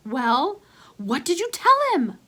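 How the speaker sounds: put-on high, loud boy's question; Ace, amazed. Ace demanding to know what his friend said, amazed. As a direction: ask in wide-eyed disbelief.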